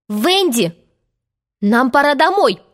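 urgent cry. A narrator's reading. Brothers want Wendy to take them home, crying out. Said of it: "закричали Джон и Майкл".

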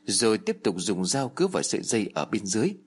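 ordinary delivery

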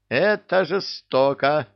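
high, slow cry of protest; protesting exclamation. A narrator's reading. Private protests his punishment to the commander, high drawn-out exclamation. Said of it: "воскликнул Валетов".

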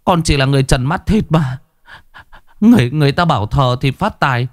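high, near-crying plea; a frightened man begging the master, voice about to break; close to tears. A narrator's reading. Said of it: "giọng run run như muốn khóc".

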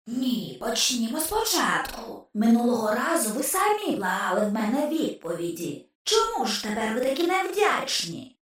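soft, otherworldly taunting voice; a skull needling the agents, hushed and sly; ghost's whisper. A narrator's reading. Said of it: "прошепотів голос". (тихий потойбічний глузливий голос черепа)

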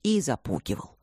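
understated crestfallen father; contrite admission. A narrator's reading. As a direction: admit it regretfully. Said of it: "сокрушённо вздохнул любящий отец".

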